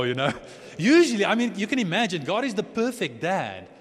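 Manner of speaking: usual speaking voice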